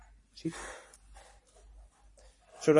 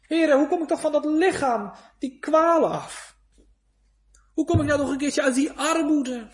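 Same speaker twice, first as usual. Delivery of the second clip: pleading prayer; 7 semitones higher than usual. Acted.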